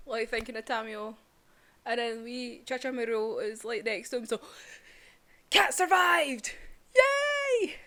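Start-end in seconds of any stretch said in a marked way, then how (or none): 5.5-7.7 high loud slow cheer. Real, not acted. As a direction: cheer for the cat. Cheering for the cat.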